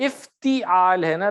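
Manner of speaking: افتعال said slowly with zer under ta; slow teacher's model of افتعال; pronunciation correction. Shown as a demonstration. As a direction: stretch out افتعال to show its vowels. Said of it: "میں وہی تلفظ، ٹھیک کرانا چاہ رہا ہوں".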